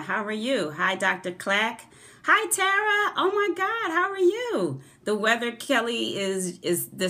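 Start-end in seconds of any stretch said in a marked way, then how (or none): 2.2-4.8 much higher, squealing with delight at a friend; delighted greeting. Real, not acted. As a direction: greet her like a long-lost friend.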